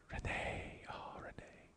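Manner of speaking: breathy whisper of a name. Real, not acted. Host whispering cohost's name, hushed.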